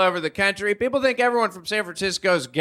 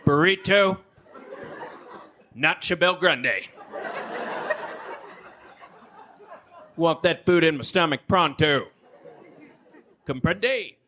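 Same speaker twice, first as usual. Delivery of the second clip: Texas drawl; slower than usual. Retold or imitated. Angry customer yelling at Taco Bell staff, Texas drawl. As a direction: play the impatient Texan at Taco Bell. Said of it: "It went something like this".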